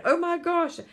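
amazed exclaim. Retold or imitated. Retold moment of amazed realization.

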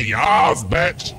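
high, drawn YES with a kick; escalated sassy yes. Retold or imitated. Topping the Emperor's yes, high exuberant comeback. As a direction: top the earlier yeses, pitched high. Climax of escalating exchanged yeses.

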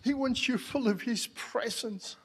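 normal talking voice

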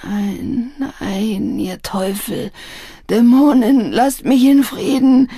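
building from pleading to loud, high cries; raving. A narrator's reading. Addict fights off demons in opium delirium, raving. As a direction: rise into a tormented rant. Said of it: "Er fing an zu toben".

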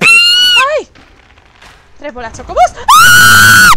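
high piercing shriek of fright; startled scream. Real, not acted. Player shrieking as the ball flies at her. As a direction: scream as the boss lunges.

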